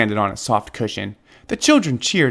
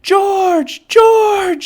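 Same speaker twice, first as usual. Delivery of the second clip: high, loud calling shout; 6 dB louder than usual. A narrator's reading. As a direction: call him back, shouting. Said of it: "she shouted".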